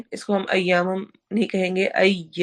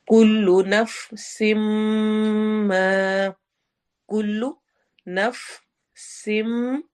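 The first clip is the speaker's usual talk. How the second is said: very slow chant, nasal hum held; Quran recitation; 2 semitones higher, much slower than usual. Shown as a demonstration. Teacher reciting with nasal merging, slow chant. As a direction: hold the nasal m long. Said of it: "इदगाम with गुन्ना हो रहा है".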